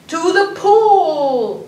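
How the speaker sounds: high, loud, slow shout; yelling. Acted. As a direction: call it out across the yard. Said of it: "I yelled".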